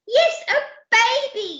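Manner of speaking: high, loud insistent shout; yelled. Acted. Percy confirming to the crew, high insistent yell. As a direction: yell it back emphatically. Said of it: "yelled Percy".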